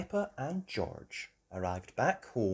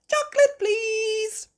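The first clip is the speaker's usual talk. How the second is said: very high, loud little-sheep voice; Susie Sheep; 7 dB louder than usual. Acted. Susie Sheep ordering from the boat, high child voice. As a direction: sing it out high and sweetly.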